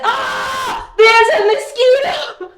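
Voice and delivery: high, loud panicked cry; real fright at a mosquito, shrieking; startled shriek. Real, not acted. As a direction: jump at a sudden mosquito.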